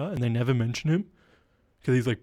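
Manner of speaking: ordinary talk, nothing marked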